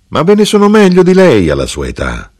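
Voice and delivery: high-pitched, piqued correction; dismissive rivalry. A narrator's reading. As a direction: push back against the praise. Mother boasts in rivalry with widow.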